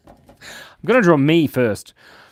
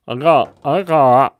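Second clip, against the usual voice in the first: gagging; 6 dB louder than usual. Real, not acted. Gags on something bad-tasting.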